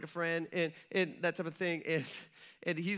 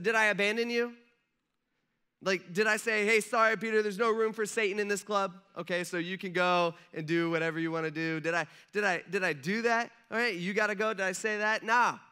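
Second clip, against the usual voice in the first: challenging Jesus; 3 semitones higher, 6 dB louder than usual. Pretend. Jesus challenging Peter about being abandoned, louder. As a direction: press him with mock indignation.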